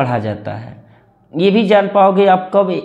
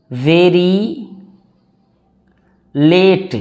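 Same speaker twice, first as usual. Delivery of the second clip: very slow, one word at a time with long gaps; word-by-word dictation; much slower than usual. Shown as a demonstration. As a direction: read it out word by word for the class to repeat. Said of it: "मेरे साथ दोहराओ, आपको ऐसे ही दोहराना पड़ेगा". (धीरे-धीरे एक-एक शब्द बोलकर दोहरवाना)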